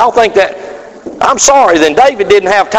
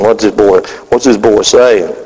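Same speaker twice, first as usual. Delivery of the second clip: puzzled father; 8 semitones lower than usual. Retold or imitated. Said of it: "Daddy's thinking".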